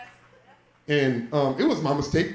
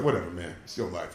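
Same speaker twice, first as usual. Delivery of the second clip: lower, softer, giving up; dad letting it slide, muttered resignation; resigned; 10 semitones lower, 7 dB quieter than usual. Retold or imitated. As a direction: shrug it off under your breath. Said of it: "I was like".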